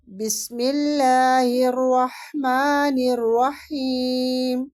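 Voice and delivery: slow, drawn-out tajweed recitation; Quran recitation. Shown as a demonstration. Teacher shows students how to recite with izhar, slow and melodic. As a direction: chant each word clearly and slowly. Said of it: "exercise سے سیکھتے ہیں".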